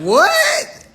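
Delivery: very high, loud mock-shocked cry; shocked disbelief. Teammate hearing they're from Charleston, shocked disbelief. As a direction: squeal it in disbelief. Acted shock at their answer.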